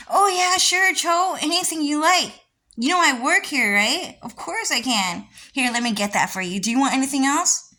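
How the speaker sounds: high, loud, eager-to-please voice; hammed-up helpful worker. Worker answering the request, high and eager.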